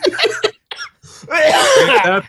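slow, stretched drawl; drawn-out. Acted. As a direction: stretch out the words. Drawn-out agreement.

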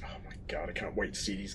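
soft breathless whisper. Retold or imitated. Host recalling the thrill of first watching, whispered. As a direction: hush it in awe. Excited memory of watching film.

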